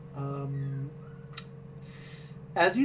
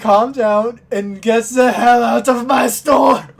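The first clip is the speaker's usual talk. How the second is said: angry shout; 3 semitones higher, 7 dB louder than usual. Acted. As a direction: throw them all out at full volume. Shopkeeper throws everyone out.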